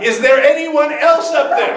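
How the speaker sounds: high-pitched comic call up a cliff; man calling upward. Acted. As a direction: call out hopefully for some other rescuer, hammed up. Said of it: "And he said".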